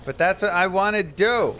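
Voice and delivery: put-on high, loud child's protest; protesting little kid. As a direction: plead loudly like a small kid.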